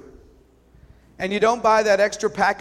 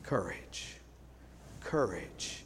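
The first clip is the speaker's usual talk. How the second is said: very soft, low hushed courage; whispered courage; 9 semitones lower, 13 dB quieter, slower than usual. Retold or imitated. Strangers urging him up the hill, barely whispered. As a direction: breathe it as a quiet push of strength. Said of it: "were whispering to him".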